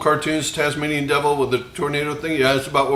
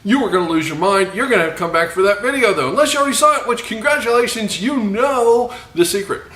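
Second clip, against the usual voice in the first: excited, higher-pitched salesman pitch; used car salesman; 6 semitones higher than usual. Pretend. Host hypes his barbecue sauce secret to viewers, used car salesman voice. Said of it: "I got my used car salesman voice on".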